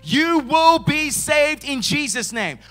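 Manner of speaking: full-force shouted proclamation; big shout. Shown as a demonstration. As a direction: proclaim it like a shout to the whole world. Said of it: "He shouts salvation".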